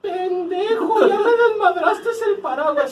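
high, loud, laughing mock wail of an old woman; shrieking old lady. Man imitating the señora whose umbrella broke, crying out.

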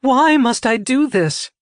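high, drawn-out desperate cry; distraught plea at high pitch; anguished question. A narrator's reading. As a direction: demand a reason, slow and high. Citra demands reason for cruel test.

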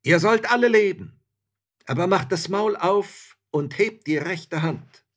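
loud, high proclamation; ringing, commanding call; shouted toast. A narrator's reading. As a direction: soldier bellowing a toast over the crowd. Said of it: "und rief".

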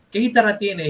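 lightly voiced eager guitarist; curious stranger.

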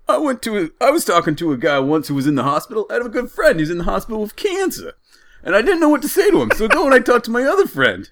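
high cartoon Jersey storytelling voice; Jersey accent. Driver recounts his friend with cancer, hammed-up Jersey accent. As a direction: spin a tale as an exaggerated Jersey driver. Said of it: "The voice you're doing".

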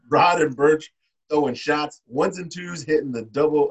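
ordinary talk

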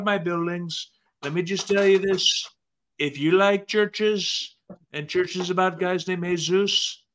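bragging rally-speech Trump voice; Trump impression. Co-host pitching votes to Adelaide as Trump, bragging. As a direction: sell yourself to the crowd like a campaigning Trump. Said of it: "you do a really good impersonation of him".

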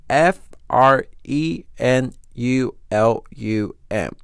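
slow, loud letter-by-letter spelling. Real, not acted. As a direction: spell it out one separate letter at a time. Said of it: "I don't know how to say this word".

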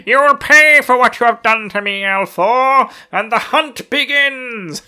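high, loud, menacing villain threat; vengeful villain. Retold or imitated. Fane vows to hunt Rand, menacing villain threat. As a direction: growl the threat like a gloating madman. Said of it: "cackling away, going".

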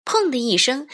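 plain reading voice

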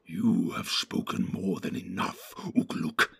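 low, sinister orc voice; low, whispery creature contempt; evil sneer. A narrator's reading. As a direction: sneer at a rival with menace. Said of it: "sneered the evil voice".

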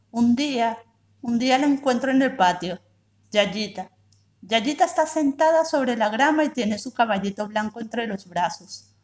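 ordinary delivery, nothing marked

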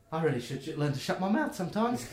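self-chiding gnome. Acted. Gnome captain chides herself.

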